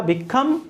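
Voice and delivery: "become" with COME stressed; teacher's demo, be-COME in "become"; stress on second syllable. Shown as a demonstration. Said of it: "second syllable पर stress है".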